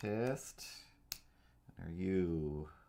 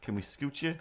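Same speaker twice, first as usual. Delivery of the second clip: usual speaking voice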